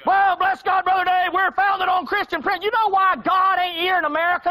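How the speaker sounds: high, loud indignant objection; mock patriot objector. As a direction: protest it like an offended patriot. Voicing patriot objector to Dave.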